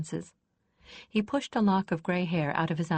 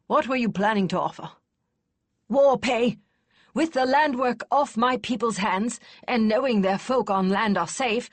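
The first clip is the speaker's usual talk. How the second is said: put-on louder, higher gruff old lady; haggling voices; 6 semitones higher, 5 dB louder than usual. A narrator's reading. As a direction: drive the bargain hard. Kester and Marshal haggling.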